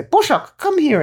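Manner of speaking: high, loud sing-song call to a cat. Retold or imitated. Granny calling her cat to her lap, high and loud. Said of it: "She would say".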